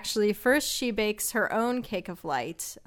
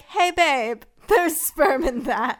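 put-on casual boyfriend voice; 7 semitones higher, 8 dB louder, slower than usual. Retelling boyfriend's casual warning.

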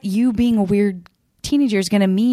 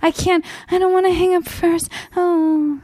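put-on high, pleading teenage whine; mock teen whining; 12 semitones higher than usual. Teen to phone sweetheart, high mock whine. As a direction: ham up a lovesick teen who can't hang up. Playing teen refusing to hang up.

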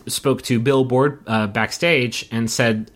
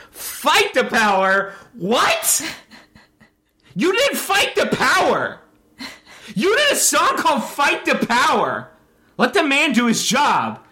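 incredulous outburst; 11 semitones higher than usual. Real, not acted. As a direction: throw the words back in high, baffled disbelief. Incredulous rant at the quoted statement.

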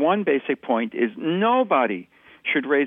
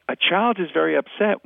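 ordinary delivery

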